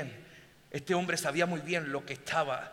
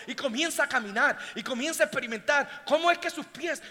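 usual speaking voice

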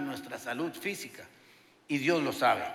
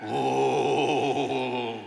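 low, ghostly moan of a door at night; deep creaking groan in the dark; spooky door groan; 6 semitones lower than usual. Shown as a demonstration. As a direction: make the door sound haunted. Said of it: "y en la noche suena".

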